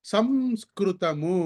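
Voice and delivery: సంస్కృతము very slow with lips closed on the m; teacher modelling సంస్కృతము. Shown as a demonstration. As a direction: say సంస్కృతము slowly, lips shut for the nasal. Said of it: "నోరు మూసి పలకాలి".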